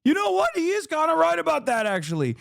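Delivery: a right-winger hearing it goes along, imitating his voice; convinced right-winger. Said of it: "is gonna be like".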